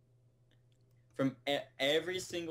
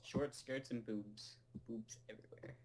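fading to a whisper; 3 semitones lower, 11 dB quieter than usual. Pretend.

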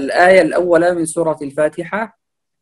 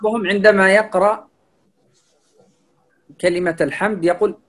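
normal talking voice

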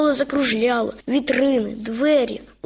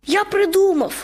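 sudden exclamation; 7 semitones higher than usual. A narrator's reading. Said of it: "раптом вигукнув ведмедик".

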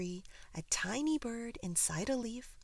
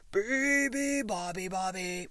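lion's roar; 8 dB louder than usual. A narrator's reading. Lion waking the sleeping bird, loud roar. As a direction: roar the bird's song like a lion. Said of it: "roared the lion".

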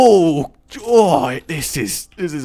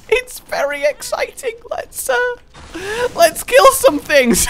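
giddy excitement; 4 dB louder than usual. Real, not acted. Giddy high voice.

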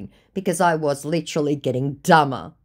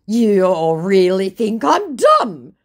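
loud, slow, higher-pitched protest; mock offended scammer; 6 semitones higher, 8 dB louder, slower than usual. Scammer objecting to her insults, mock offended. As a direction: whine it in hammed-up offence. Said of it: "he says".

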